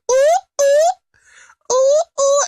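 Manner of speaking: very high, sing-song loud hooting; playful Buu cooing. Retold or imitated. Buu dancing around Kai in the sky, high playful hoots. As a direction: hoot like a giddy childlike blob. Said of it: "floating in the sky going".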